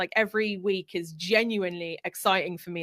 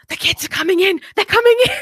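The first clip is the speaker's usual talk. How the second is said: high, loud panicked cry; hammed-up alarm, kids bursting in; imitating a frantic teacher shouting a warning; mock panic; 11 semitones higher, 12 dB louder, faster than usual. Retelling frantic call interruption.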